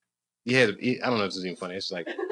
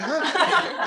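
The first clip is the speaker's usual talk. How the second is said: baffled huh. Retold or imitated. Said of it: "we were just sitting there like".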